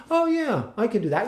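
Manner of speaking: high, airy "oh"; mock breezy confidence. People presuming they could act, mocking high voice. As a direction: toss it off as if acting were easy. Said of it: "they're like".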